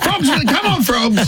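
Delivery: high-pitched mock cheer; pub punter cheering. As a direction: cheer a dog on like you're at the pub with mates. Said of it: "at the pub with your mates going".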